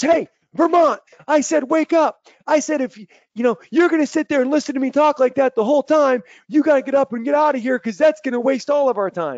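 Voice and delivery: imitating a fired-up speaker yelling, high and loud; high-pitched, forceful retold tirade; rousing shout. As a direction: holler to jolt a sleepy crowd awake. Imitates speaker jolting the audience awake.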